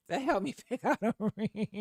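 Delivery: real laughter overtaking the end of the line; laughing through words. Real, not acted. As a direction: dissolve into giggles while finishing the sentence. Laughing at her own mistake.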